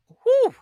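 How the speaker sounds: loud, high-pitched "whew"; high whoop. Real, not acted. Host reacts to the ending, loud high whew. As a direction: let out a loud high exhalation of excitement.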